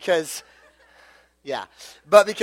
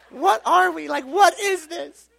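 put-on pleading girlfriend; 10 semitones higher, slower than usual. Girlfriend demanding an answer, breakup.

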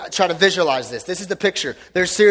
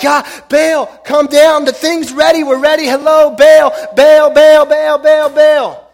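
high, loud desperate chanting of Baal; frantic calling; 9 semitones higher, 9 dB louder, slower than usual. Retold or imitated. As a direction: beg the idol at full voice, over and over. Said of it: "the Bible says they're like".

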